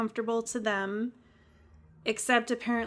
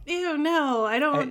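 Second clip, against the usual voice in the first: higher-pitched recoiling "ew, no"; mock squeamish refusal; 3 semitones higher than usual. Host voicing Wesley turning down the aliens, mildly grossed out. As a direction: back away from it, lightly acting the reluctant boy. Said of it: "'cause he's like".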